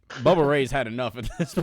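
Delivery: ordinary talk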